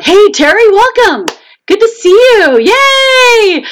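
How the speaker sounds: high, delighted welcome with a drawn-out cheer; excited greeting. Real, not acted. Host spots a viewer joining the stream, excited greeting. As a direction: greet a new arrival in a high, happy squeal.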